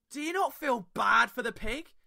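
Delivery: lightly voiced vegan coworker, mock reproach; coworker's question. Retelling a coworker questioning his bacon roll, mock reproachful. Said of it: "and goes".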